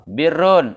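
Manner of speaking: "birun" read with an i on the ba, bi-run; birun with a kasrah. Shown as a demonstration. Teacher showing the kasrah reading to students, clearly pronounced. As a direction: say "birun" with i on the first letter. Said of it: "Ente mau baca kasroh".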